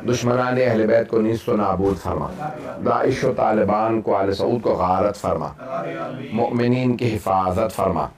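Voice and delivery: the preacher's low chanted dua for the congregation; prayer. Acted. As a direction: intone the closing prayer, grave and even. Closing Arabic prayer and dua.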